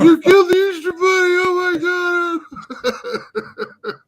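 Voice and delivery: put-on high, loud, wailing child's voice; crying kids. Kids crying at dad over the Easter bunny, sobbing wail. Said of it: "the kids just start crying".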